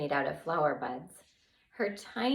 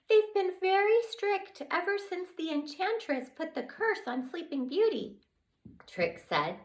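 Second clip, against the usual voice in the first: high small voice; tiny fairy explaining the council; 11 semitones higher than usual. Acted.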